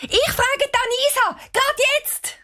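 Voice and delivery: put-on high, thrilled girl's voice; girl blurting her plan, very high and eager; excited girl. A narrator's reading.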